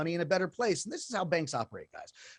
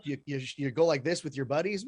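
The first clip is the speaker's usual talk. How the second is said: normal talking voice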